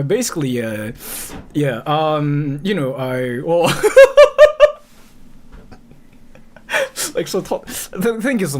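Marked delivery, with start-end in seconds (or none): 3.8-4.8 short loud high laugh; burst of laughter. Real, not acted. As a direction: crack up again. Laughs again mid-thanks.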